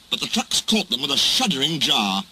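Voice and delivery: ordinary delivery, nothing marked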